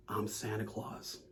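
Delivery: low, hushed, slow Batman-style confession; solemn superhero reveal, slow and very soft; semi-gravelly voice. Retold or imitated. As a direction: drop it deep and quiet, lightly gravelled. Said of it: "like, a semi-gravelly voice".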